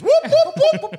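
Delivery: high, loud clucking whoops; chicken noise. Shown as a demonstration. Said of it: "just make a chicken noise".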